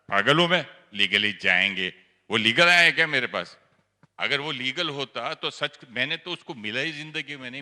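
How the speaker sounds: loud, fast indignant ranting; retold angry outburst. On the phone to Didi, furiously refusing to go legal. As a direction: blow up at the calm advice, fuming. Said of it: "मेरे को बड़ा गुस्सा आया".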